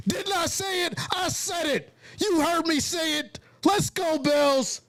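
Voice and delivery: high-pitched, elated crowing; real exultant sports-fan glee; triumphant celebration. Real, not acted. Celebrating his called touchdown play.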